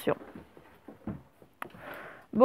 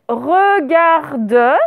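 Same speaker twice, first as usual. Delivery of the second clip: drawn-out "Regarde", syllable by syllable; dictation; 3 semitones higher, 4 dB louder than usual. Shown as a demonstration. Teacher dictates a word to the class, drawn-out dictation. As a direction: dictate "Regarde" slowly, each syllable distinct.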